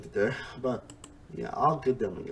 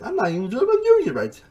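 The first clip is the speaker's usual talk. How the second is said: bad Australian accent; 10 semitones higher, 9 dB louder than usual. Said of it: "my terrible impression of Australian accent".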